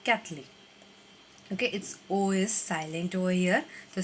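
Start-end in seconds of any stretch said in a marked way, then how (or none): 0.1-0.5 कैथलिक said kath-lik, the o dropped; correct pronunciation. Shown as a demonstration. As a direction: say कैथलिक in two syllables. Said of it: "इसका सही pronouncing यहाँ पर दिया गया है".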